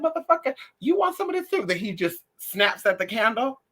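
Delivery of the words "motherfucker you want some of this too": much higher, taunting challenge; high-pitched mock threat at the dog. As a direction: shriek a dare at the dog. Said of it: "and I said".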